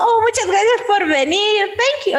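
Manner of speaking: high, bright-pitched cheerful thanks; bubbly idol. Retold or imitated. Idol thanking a fan at a meet, high and cheerful. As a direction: beam the thanks out. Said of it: "que también me dijo".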